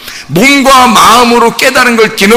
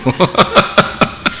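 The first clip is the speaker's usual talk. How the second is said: soft, rapid run of chuckles; 6 dB quieter than usual. Real, not acted. Preacher amused by his line about husbands' sea-like hearts, chuckling. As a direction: chuckle to yourself after the joke.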